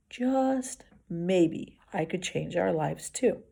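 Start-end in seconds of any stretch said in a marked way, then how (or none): none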